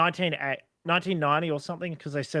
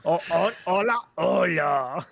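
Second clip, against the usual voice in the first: mock Spanish accent; 2 semitones higher, 3 dB louder, slower than usual.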